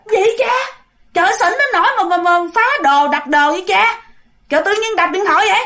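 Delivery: high, fast, puzzled villager asking; mock a baffled passerby; stranger's retort. Stranger scolding the drunk man, high and fast.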